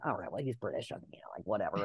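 whispered, shrugging it off; dismissive whisper. Retold or imitated. Casting team shrugging off the British kid, dismissive whisper. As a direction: hush it like a private eye-roll.

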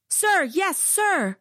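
high barked sir yes sir; barked military recruit reply. A narrator's reading. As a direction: bark it back like a recruit. Chant is deafening.

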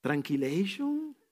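playful voice of Jesus. Retold or imitated. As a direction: tease gently, then break into a comic squeal. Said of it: "lo que el Señor le dijo a Marta". (Jesús le dice a Marta, juguetón y suave)

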